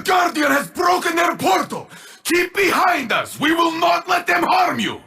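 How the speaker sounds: high, ringing war cry; battle shout. Acted. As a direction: bellow it over the fight to shield them. Same battle cries repeated.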